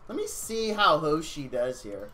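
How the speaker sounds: ordinary talk, nothing marked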